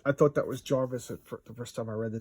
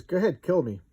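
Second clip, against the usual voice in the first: defiant dare; 7 semitones higher, 8 dB louder than usual. Acted. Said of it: "And he goes".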